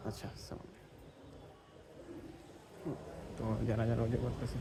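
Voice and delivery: whispered greeting, barely audible; real whisper. Real, not acted. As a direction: whisper it so the prayer isn't disturbed. Greeting beside people at prayer. (ফিসফিস করে সালাম দেওয়া)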